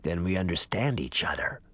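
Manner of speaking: low, creaky ancient man. Acted. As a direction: creak it out like a parched old man. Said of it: "the voice said".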